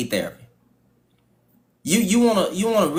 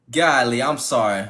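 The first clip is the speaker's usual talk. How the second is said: ordinary delivery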